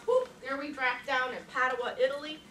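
usual speaking voice

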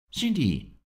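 high, loud call to a companion. A narrator's reading. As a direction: call out sharply for attention. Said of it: "rief Halef".

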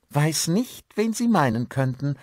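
high-pitched local woman; high character voice; old village wife. A narrator's reading.

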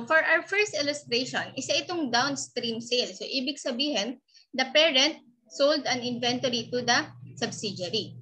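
usual speaking voice

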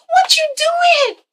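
high, stretched-out outraged cry; shrieking what you doing. Acted. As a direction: screech it at him in disbelief, slowly. Mock outraged question at killer.

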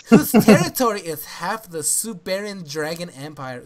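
loud, hammed-up flamboyant reading. Reading a fantasy summary to friends, loudly flamboyant. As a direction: belt out the summary flamboyantly, half laughing. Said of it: "flamboyant voice".

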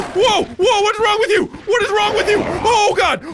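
high frantic screams of no; panicked screaming. Real, not acted. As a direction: scream in terror as it grabs you. Zombie attacks, screaming in panic.

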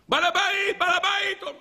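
high-pitched cry for the master; servant's alarmed yell, acted out; screaming servant. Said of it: "המשרת צורח".

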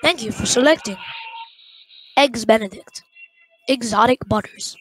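lightly voiced automated game announcer; Eggs Benedict. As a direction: read it out like a menu machine, in short chunks. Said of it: "Funtime Freddy and Eggs Benedict".

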